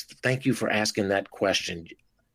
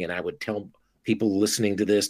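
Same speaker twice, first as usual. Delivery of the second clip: ordinary delivery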